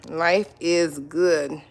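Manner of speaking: slow and savoring. Real, not acted. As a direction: stretch out Life is good very slowly. Savoring that life is good.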